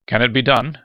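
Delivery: loud, pressing question; demanding. A narrator's reading. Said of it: "Gannett demanded".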